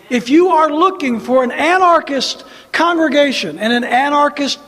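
loud defiant rallying preaching; rally the congregation, fired up; roaring rallying speech. Acted.

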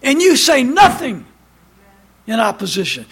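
loud, high pulpit cry; fiery preaching. Acted. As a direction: preach it at full force to the pews. Preacher at full force.

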